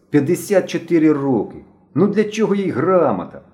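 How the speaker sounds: put-on high, loud boyish scoffing; brash, challenging question; cocky. A narrator's reading. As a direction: argue cheekily, as if it's absurd. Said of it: "задирикувато продовжував".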